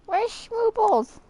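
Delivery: slow, loud sing-song call. Real, not acted. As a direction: call the dog by name. Calls the dog, drawn out.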